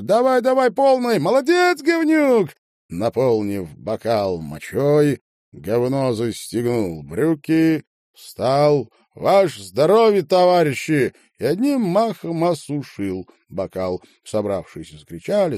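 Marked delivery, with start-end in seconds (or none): 0.0-2.5 very high, loud egging-on; shrieked crowd cheer. A narrator's reading. 9.1-11.1 raised, high proclamation; hearty loud announcement; loud toast. A narrator's reading. Proposing a toast.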